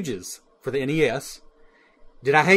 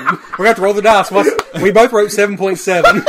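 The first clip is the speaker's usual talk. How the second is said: loud, higher, through laughter; laughing; 4 semitones higher, 6 dB louder than usual. Real, not acted. Host revealing both guesses match, laughing loudly. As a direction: crack up while announcing it. Both wrote the same number.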